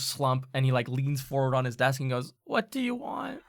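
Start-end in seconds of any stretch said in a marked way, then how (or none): 2.4-3.4 high-pitched, weary exasperation; exasperated tinkerer, pitched up. Acted. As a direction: give in with a high, put-upon voice. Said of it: "lets out an exasperated sigh".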